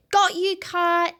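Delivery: high, loud gleeful grab; triumphant Wanda. Acted. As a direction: gloat like you've won it. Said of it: "she said".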